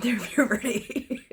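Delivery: ordinary talk, nothing marked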